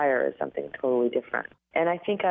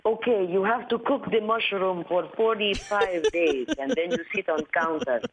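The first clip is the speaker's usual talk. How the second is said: preachy advice-giver; 6 semitones higher than usual. Retold or imitated. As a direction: lay out the remedy like a self-appointed health guru. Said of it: "well-intended people were like".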